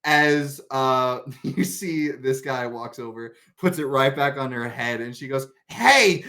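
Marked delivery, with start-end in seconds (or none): 5.7-6.2 loud, high yelp of protest; sudden raised in-character "Hey!"; startled hey. Acted. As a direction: cry out, caught off guard. Character startled as hat is moved.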